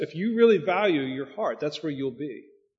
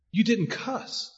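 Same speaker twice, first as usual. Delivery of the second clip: amazed; 6 semitones higher, much slower than usual. Retold or imitated. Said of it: "he was amazed about what".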